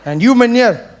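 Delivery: lightly voiced officer telling off a drunk; police officer. Officer scolding Meneer, mock stern. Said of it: "police scene".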